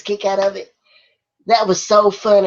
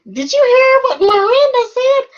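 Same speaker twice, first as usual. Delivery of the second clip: imitating a guy's voice gone squeaky high; loud, mock falsetto question, way above your own pitch; voice shooting up octaves; 5 dB louder than usual. Retelling his stumble up to the English teacher, loud and very high-pitched. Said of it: "his voice went up about three octaves".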